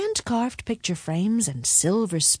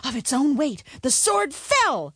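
ordinary delivery

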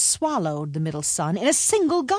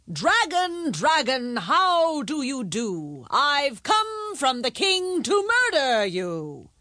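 loud and firm; 8 semitones higher, slower than usual. A narrator's reading. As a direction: chant it high and slow. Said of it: "You say it very loudly and firmly".

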